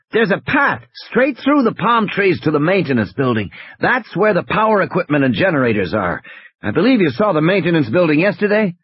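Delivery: high, urgent radio question; pressing, animated instructions. Acted.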